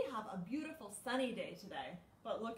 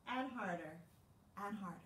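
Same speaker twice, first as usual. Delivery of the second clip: usual speaking voice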